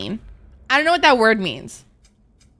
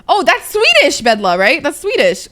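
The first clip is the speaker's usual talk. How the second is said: high, loud burst of recognition; suddenly louder and higher, delighted to know it; excited recognition; 6 semitones higher, 6 dB louder than usual. Real, not acted. Streamer delightedly recognizing a chat greeting, excited.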